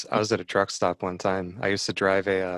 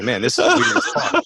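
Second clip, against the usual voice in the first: much higher, laughing squeal; laughing disbelief; 6 dB louder than usual. Retold or imitated. Retelling the truck stop stall, laughing disbelief.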